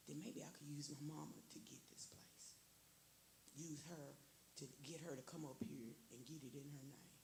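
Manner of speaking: hushed whisper of a private plan; whispered scheming. Retold or imitated. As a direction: breathe it out like a secret thought. Secret plan spoken to herself.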